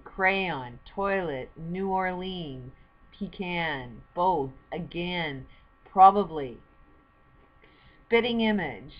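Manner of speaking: loud, slow word-by-word reading of each word; careful pronunciation demo. Shown as a demonstration. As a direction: sound out every word for the viewer. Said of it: "help you pronounce it".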